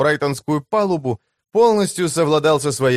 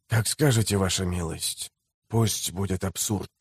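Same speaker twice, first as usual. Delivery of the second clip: restrained slave trader; 7 semitones lower, 8 dB quieter than usual. A narrator's reading. Slave trader bows to the mayor, coolly deferential. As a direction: yield quietly, keeping the voice low and controlled. Trader yields with slight bow.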